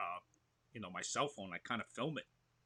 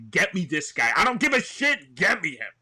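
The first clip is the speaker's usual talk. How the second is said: loud, high, emphatic outburst; hammed-up, pushy insistence; insistent demand; 6 semitones higher, 12 dB louder than usual. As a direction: demand it from the coach at full volume. Retells insistent demand to coach.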